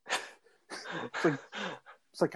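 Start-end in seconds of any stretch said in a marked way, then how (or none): none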